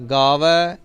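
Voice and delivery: ਗਾਵੈ ਦੁਲਾਵਾਂ ਨਾਲ; correct pronunciation. Shown as a demonstration. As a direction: stress the ਐ of ਗਾਵੈ. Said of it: "ਇਹਨੂੰ ਗਾਵੇ ਨਹੀਂ ਕਹਿਣਾ".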